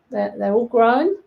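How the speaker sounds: normal talking voice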